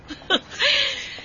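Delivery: sudden high delighted laugh; high burst of laughter. Acted. Sister Guan laughing high at the neighbour's joke.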